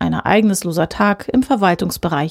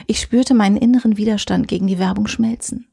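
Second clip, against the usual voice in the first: ordinary delivery, nothing marked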